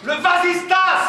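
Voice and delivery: high, slow, loud yell; exasperated shout. Acted. Brother did not hear the order, exasperated shout. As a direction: shout the word out slowly.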